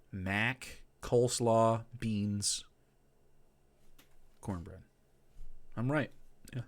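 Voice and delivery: normal talking voice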